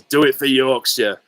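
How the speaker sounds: rousing advert line in amateur Yorkshire; Sean Bean. Acted. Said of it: "Sean Bean where he says".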